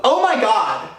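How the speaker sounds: loud, high burst of surprise; surprised exclamation. Retold or imitated. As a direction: blurt it out in shock. Surprised to see Andrea.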